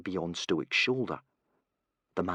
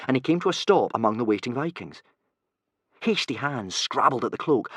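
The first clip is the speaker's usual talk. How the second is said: usual speaking voice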